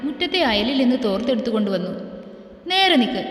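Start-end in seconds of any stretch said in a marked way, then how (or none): 2.7-3.2 higher-pitched curt command, read aloud; a touch of no-nonsense firmness; stern order. A narrator's reading. Man towelling off his scolded partner, mildly stern order. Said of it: "ഒട്ടും മയമില്ലാതെ അവൻ അവളോട് പറഞ്ഞു".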